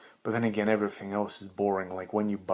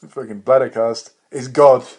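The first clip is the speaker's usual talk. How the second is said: much louder, higher proclamation; shouted self-worship; mock god boast; 3 semitones higher, 15 dB louder than usual. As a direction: proclaim it like a mad deity.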